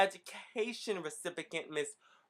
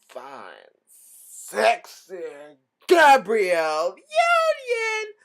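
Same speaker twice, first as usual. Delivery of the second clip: excited shout; 3 semitones higher, 12 dB louder, much slower than usual. Acted. Hyping the host's entrance.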